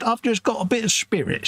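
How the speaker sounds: high, put-on voice of a feisty old lady; mock Auntie Winnie. Quoting the aunt boasting, in a high put-on voice. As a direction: chirp it proudly like a spirited little aunt. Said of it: "She said".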